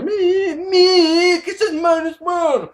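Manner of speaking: hammed-up nagging commenter voice; whiny commenter. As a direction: mimic an eager fan begging for attention. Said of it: "you all down here go".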